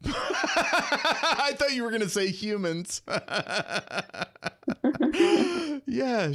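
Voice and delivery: high, bursting into laughter; real laughter at a funny idea; laughing. Real, not acted. Amused by jellyfish accent theory.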